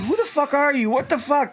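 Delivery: high-pitched, hammed-up demand; mock outraged yell. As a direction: challenge an intruder in mock hysteria. Acting out a panicked man yelling.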